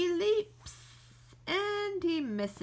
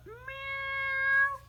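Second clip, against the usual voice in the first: high drawn-out cat meow; long high kitty meow; fake meow; 13 semitones higher than usual. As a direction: mew like a cat, much higher. Squirrel pretends to be cat.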